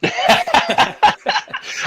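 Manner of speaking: breathy rising laugh. Real, not acted. Laughing at quarantine remark.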